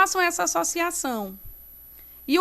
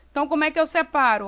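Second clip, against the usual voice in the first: normal talking voice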